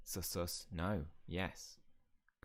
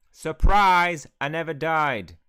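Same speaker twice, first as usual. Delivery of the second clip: high, very loud shout of a big reveal; booming, high-pitched reveal; triumphant surprise; 8 semitones higher, 17 dB louder, much slower than usual. Acted. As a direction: yell it like a dramatic comeback. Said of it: "he comes at the last chapter and goes".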